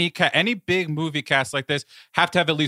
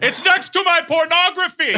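high, loud theatrical proclamation; hammed-up impression of a flamboyant patriarch; Gomez Addams; 5 dB louder than usual. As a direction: declaim it with mock grandeur. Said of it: "he's only doing Gomez Addams in this".

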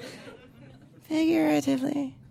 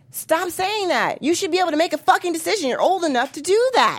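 ordinary delivery

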